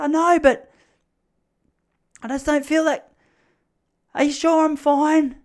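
high, loud mock-hysterical pleading; panic attack. Panicking boy begging rescuers for reassurance, hammed-up panic. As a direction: plead in a high, stretched wail. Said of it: "You're having a panic attack".

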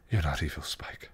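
hushed, soft reassurance; tender whisper. Acted. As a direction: whisper it gently to calm them. Tara soothes distressed Spike.

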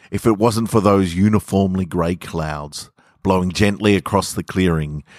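plain reading voice

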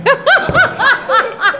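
high, loud cackling mock yeah; imitated laughing heckler. Mocking the one guy over there laughing, high laughing imitation. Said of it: "laughing, going".

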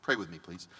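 ordinary talk

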